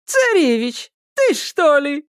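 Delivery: high-pitched kikimora character voice; high, surprised storybook creature; Kikimora recognising someone. A narrator's reading. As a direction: squeal it in sudden recognition. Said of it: "узнала Кикимора".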